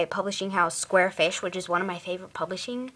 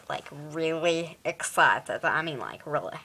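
hammed-up emphasis; 4 semitones lower than usual. Vlogger stressing eagerness for a book, hammed-up emphasis. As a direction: lean heavily on each repeat, dropping in pitch. Hammed-up repeats of her excitement.

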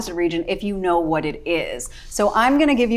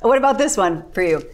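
ordinary delivery

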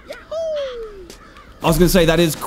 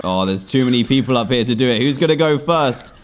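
usual speaking voice

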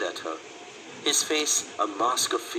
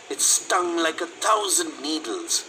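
plain reading voice

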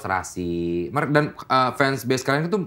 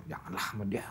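whispered warning, much softer; retold whisper; 5 semitones lower, 15 dB quieter than usual. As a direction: hush it like a secret aside. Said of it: "gua bilang".

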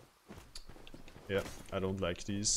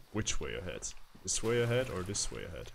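ordinary talk

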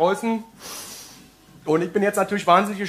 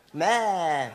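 sheep bleat; 4 semitones lower, 4 dB quieter than usual. Retold or imitated. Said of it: "sie fing an zu spielen".

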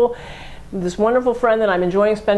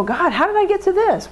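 dismayed realization; 12 semitones higher than usual. Retold or imitated. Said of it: "and we go".

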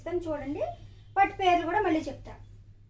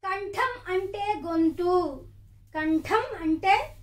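slow dictation; 4 dB louder, much slower than usual. Shown as a demonstration. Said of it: "slow dictation".

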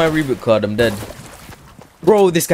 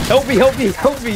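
high, loud pleading help cries; mock cry for help; 13 semitones higher, 5 dB louder than usual. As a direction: squeal for help like a victim.

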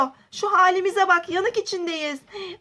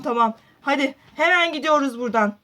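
ordinary talk, nothing marked

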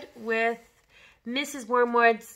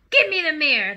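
loud, raised demanding yell; yelling father; 4 semitones higher, 9 dB louder than usual. Acted. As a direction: demand it at the top of your voice. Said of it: "the father yelled".

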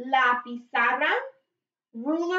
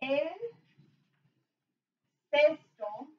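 "El cesto" spaced out slowly; slow demonstration; 3 semitones higher, 3 dB quieter than usual. Shown as a demonstration. Teacher showing how the phrase goes, slowly. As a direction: pause between "El" and "cesto". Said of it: "So it goes like this".

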